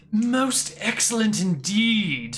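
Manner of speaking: slow, high, lofty royal approval; grand, courtly delight; refined fluent prince. Acted. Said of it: "switches back as well".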